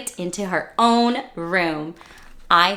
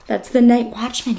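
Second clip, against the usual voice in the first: plain reading voice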